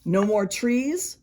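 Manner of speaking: ordinary delivery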